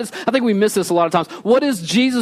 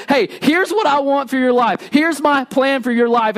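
calling out; 8 semitones higher than usual. Acted. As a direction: call out the plan with eager force. God calling out his plan.

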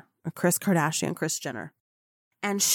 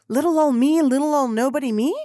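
higher, playful poor-little-me voice; mock-humble; 6 semitones higher, 4 dB louder than usual. Newcomer among seasoned reporters, mock-humble. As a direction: play up being a tiny nobody. Self-mocking aside as a nobody.

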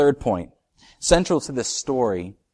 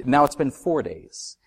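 ordinary talk

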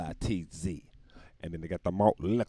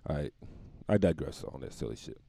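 normal talking voice

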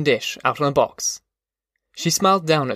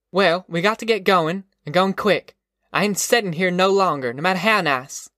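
higher-pitched rural mother, firm and impatient; tough, determined mother; 5 semitones higher than usual. Acted. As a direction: lay down the law to the family.

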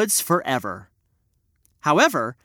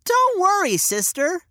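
put-on high, soothing little boy; a boy calming his sister; young boy voice; 13 semitones higher than usual. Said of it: "he said".

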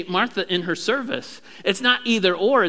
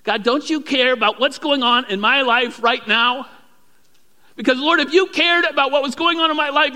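louder, higher pleading complaint, building; complaining prayer; 7 semitones higher, 5 dB louder than usual. Acted. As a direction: pour out a frustrated prayer, slowing at the end. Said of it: "have you ever said that".

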